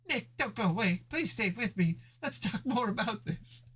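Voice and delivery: put-on high, begging girlfriend voice; pleading Judy. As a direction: beg him not to leave, pitched high.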